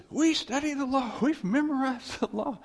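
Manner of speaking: high, loud mocking voice of self-righteous rule-keepers; mock religious boast. Preacher mocking the religious crowd with a high, loud sneer. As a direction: lampoon the proud law-keepers, high and loud. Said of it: "you're the religious".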